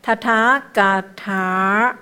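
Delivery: slow 塔塔嘎塔 with long held 塔; long vowel. Shown as a demonstration. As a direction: draw out each long 塔 in 塔塔嘎塔. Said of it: "这是长音的".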